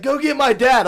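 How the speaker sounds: high, loud plea from the hurt friend; injured friend. Retold or imitated. Injured teen begging friends to fetch his dad, loud high plea. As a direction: beg loudly for your dad. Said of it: "And he was like".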